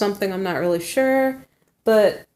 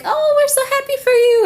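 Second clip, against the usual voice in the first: much higher and louder, squealing with joy; excited friends cheering; 6 dB louder than usual. Retold or imitated. Girlfriends on the phone celebrating her new guy, high excited squeal. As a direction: gush like thrilled girlfriends on the phone. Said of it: "the girls are on the phone and they're like".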